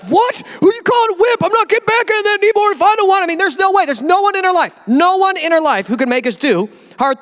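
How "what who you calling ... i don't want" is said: indignant husband. Acted. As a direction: bristle like a husband insulted by his wife. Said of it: "it's like".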